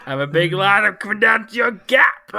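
high, loud playful bellow; booming mock shout. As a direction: shout it like a hulking block. Acting as a Tetris piece.

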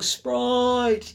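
high, drawn-out mock yell; mock shout. Mimicking people shouting Sprite in the film, mock shout. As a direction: call the name out in exasperated imitation. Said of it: "just people shouting".

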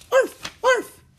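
very high, loud yapping arf arf; small dog barking. A narrator's reading. Teddy the dog barking happily, very high yaps. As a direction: yap like a little puppy.

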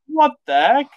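hammed-up surprised squeak; high squeak of surprise. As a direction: yelp it in mock alarm. Character voiced in retelling.

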